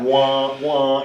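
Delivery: sung wah wah sting; mock sad trombone. Sad trombone after dad joke.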